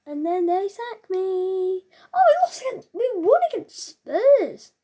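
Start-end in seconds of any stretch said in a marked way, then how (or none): 2.1-4.7 shocked yelp. Real, not acted. Gamer shocked his team beat Spurs, squealing in disbelief.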